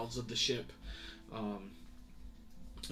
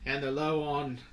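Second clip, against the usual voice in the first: higher, louder sailor voice rambling a report; chatty navy sailor; 6 semitones higher, 10 dB louder than usual. Acted. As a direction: report to the captain, puzzled and gossipy. Sailor reports search results.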